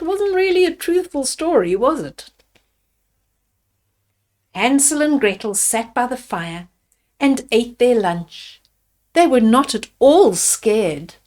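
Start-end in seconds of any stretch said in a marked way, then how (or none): none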